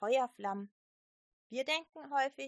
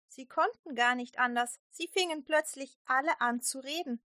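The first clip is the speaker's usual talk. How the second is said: usual speaking voice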